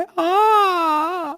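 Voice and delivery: pained cry. Acted. Child with stomach pain.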